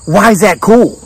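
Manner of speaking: retorting as if accused; defensive. Retold or imitated. Hostess pushes back defensively at the guest.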